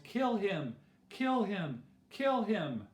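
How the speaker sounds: high, insistent chant of a mob; narrator's restrained yell, each cry falling; crowd yelling. A narrator's reading. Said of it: "they all yelled".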